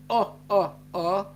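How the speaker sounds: loud rounded "Oh, oh, oh" laugh, open mouth; imitated German laugh. Explaining German laughter, loud mock German laugh. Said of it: "Germans laugh".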